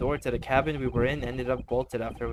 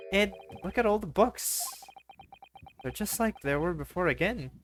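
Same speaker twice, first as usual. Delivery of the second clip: put-on young woman voice; 7 semitones higher than usual.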